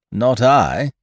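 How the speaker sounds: loud, light, carefree refusal; blithe. A narrator's reading. Captain blithely refusing the castaways mercy. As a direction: refuse without a care. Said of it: "said Ned Low blithely".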